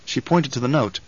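usual speaking voice

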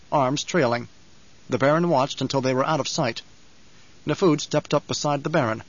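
ordinary delivery

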